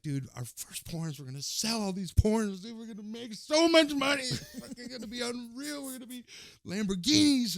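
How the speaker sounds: high, loud giddy stoner hype; hyped-up excitement. Retold or imitated. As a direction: dream out loud about getting rich. Retold hyped dream of riches.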